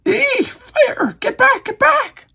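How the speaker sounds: very high falsetto panic; tiny high-pitched mock scream; mock panicked squeal. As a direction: squeal it like a terrified bystander, hammed-up. Bystanders panicking at fire.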